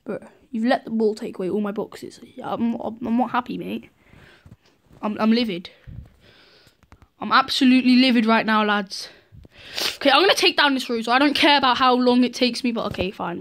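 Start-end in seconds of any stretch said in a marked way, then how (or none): none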